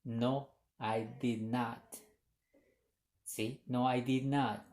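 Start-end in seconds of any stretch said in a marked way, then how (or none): 0.0-1.8 "No, I did not" slow, each word set apart; slow careful model. Shown as a demonstration. Teacher models the negative answer for students, slow and clear. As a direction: model it slowly so students catch every word. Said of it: "Y si contestamos de manera negativa".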